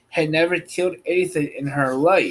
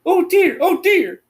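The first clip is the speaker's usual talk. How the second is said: high, louder cry of dismay; Dorothy crying out; 6 dB louder than usual. Acted. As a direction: cry out in dismay as a little girl. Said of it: "cried Dorothy".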